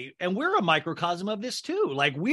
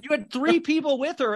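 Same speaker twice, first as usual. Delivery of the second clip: indignant mock outrage; 9 semitones higher, 4 dB louder than usual. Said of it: "And I'm like".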